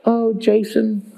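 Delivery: slow, cooing mock sweetness; hammed-up gentle doting, dragged out; mock tenderness. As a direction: drop out of preaching into syrupy, drawn-out fondness. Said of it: "He's not like".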